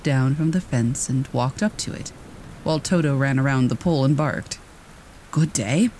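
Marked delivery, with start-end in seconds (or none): 5.3-5.9 a touch of husk on the greeting; husky. A narrator's reading. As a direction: say it with a slightly husky scarecrow voice. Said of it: "in a rather husky voice".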